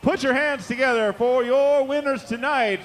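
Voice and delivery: booming ring announcer, building to a roar; grand entrance announcement. Real, not acted. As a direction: work the crowd like a boxing MC. Announcing the winning team.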